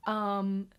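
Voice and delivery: "Um" hummed as a hesitation; English filler um. Shown as a demonstration. Vlogger catching her own English filler. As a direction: let "Um" fill the pause. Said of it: "see what I said there?".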